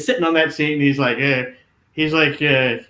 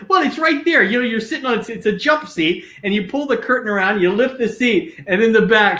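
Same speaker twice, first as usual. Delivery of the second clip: higher and brighter, eager to show him; amused explaining; 7 semitones higher than usual. Retold or imitated. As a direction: explain gleefully to the puzzled smoker. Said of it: "And I go".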